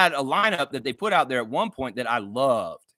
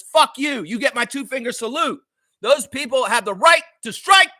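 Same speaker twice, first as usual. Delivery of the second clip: sudden higher, louder burst of anger; angry outburst; 8 semitones higher, 6 dB louder than usual. Real, not acted. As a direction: spit it at the critics, fed up. Defending postal workers, cursing at critics.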